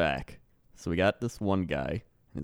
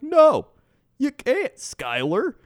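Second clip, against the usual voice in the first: very high, exasperated put-on driver; mock indignant refusal; 6 dB louder, slower than usual. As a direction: refuse emphatically, hammed up. Acted refusal by irate driver.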